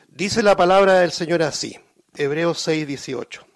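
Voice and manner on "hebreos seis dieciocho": normal talking voice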